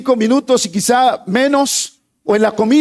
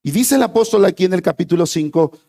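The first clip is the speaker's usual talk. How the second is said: normal talking voice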